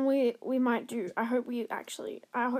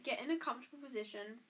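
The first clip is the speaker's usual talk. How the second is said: hypnotist voice; 2 semitones lower, 9 dB quieter than usual. Acted. Hypnotizing her friend.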